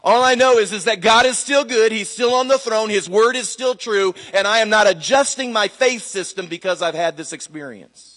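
high, loud declaration of faith; emphatic preaching. Real, not acted. Preacher declaring faith to the congregation after loss, emphatic. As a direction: proclaim it with force and conviction.

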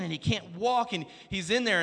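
normal talking voice

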